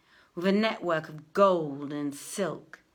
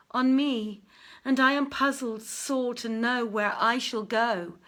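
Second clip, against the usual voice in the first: declaimed lament, a touch louder, some lines slowed; lovelorn verse recital; 3 semitones higher, 4 dB louder than usual. Acted. Youth versifying about his lost love, declaimed lament. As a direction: chant the woes of parted love.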